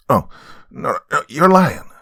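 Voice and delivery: rising to a high, loud accusation; heated denial. Acted. Betrayed man calling his friend a liar, heated. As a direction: refuse to believe it, pitch climbing sharply.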